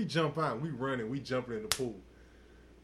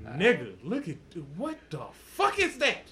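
can't believe what you're seeing; stunned exclamation; 3 dB louder than usual. Retold or imitated. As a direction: build to a stretched, astonished outburst. Retold stunned reaction to woman.

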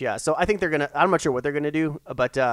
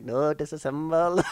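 slow put-on Indian accent; slower than usual. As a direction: imitate Johnny Five's Indian accent. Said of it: "he says it with the Indian accent".

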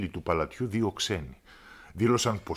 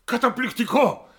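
high, loud delighted exclamation; the king thrilled by the trick; high-pitched overjoyed royal voice; enthusiastic king; 13 semitones higher, 6 dB louder than usual. Acted. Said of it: "είπε ο βασιλιάς ενθουσιασμένος".

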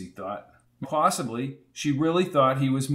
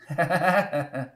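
put-on old woman chuckle; 3 semitones higher than usual. A narrator's reading. Landlady laughs.

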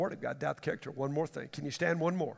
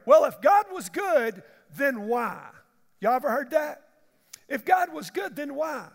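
higher, louder complaining questioner; mocking the doubter; 5 semitones higher, 5 dB louder than usual.

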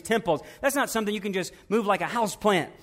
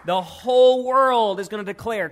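loud, rising preaching climax; preaching at full force; 4 semitones higher, 4 dB louder than usual. Real, not acted. The preacher tells the sisters the world will bow: loud, rising preaching. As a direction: build it up and proclaim to the congregation.

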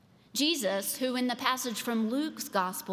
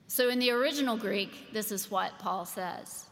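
ordinary delivery

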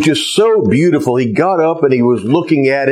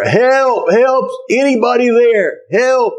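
yelling for help; 9 semitones higher than usual. Acted. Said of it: "So he yelled more".

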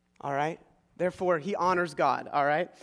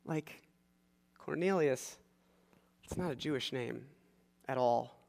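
much softer, low and skeptical; doubtful Peter; 3 semitones lower, 10 dB quieter than usual. Retold or imitated. Peter doubts the men sent by Cornelius, softly skeptical. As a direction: mutter it in disbelief. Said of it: "Peter's like".

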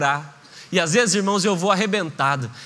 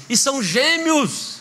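proud twins reveal; 4 semitones higher, slower than usual. Acted. Brother adds twins news.

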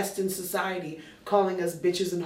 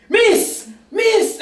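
loud high shouted calls of miss; yelling to get her attention; bus driver shouting; 11 semitones higher, 10 dB louder than usual. Retold or imitated. Bus driver yelling up the stairs at her, loud high calls. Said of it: "and he's like".

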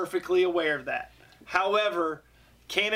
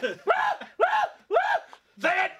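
squeaky shout. Acted. High mock shout.

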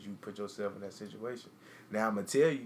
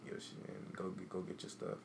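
cold quiet order; 7 dB quieter than usual. Retold or imitated. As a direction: say it flat and quiet, no anger left. Coldly ordering her out.

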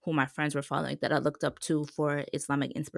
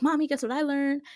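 excited; 9 semitones higher than usual. Retold or imitated. Excited kid running to mom.

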